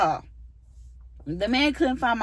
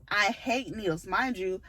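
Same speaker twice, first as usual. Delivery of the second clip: normal talking voice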